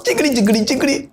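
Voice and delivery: high-pitched mock chatter; imitating callers' chatter. Callers complaining about the pastor, high mock jabbering. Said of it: "A lot of people call dey talk".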